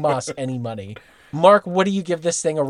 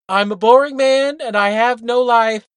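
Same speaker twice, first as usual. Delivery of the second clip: quoting Bill; 4 semitones higher, 4 dB louder than usual. Retold or imitated. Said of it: "William Fontaine de la Tour Dauterive line".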